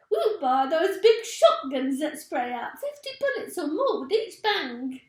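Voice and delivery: high, loud eager voice; gleeful Missus Twit. A narrator's reading. Wife dreaming of big shotguns, high and loud. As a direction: relish every bullet in it.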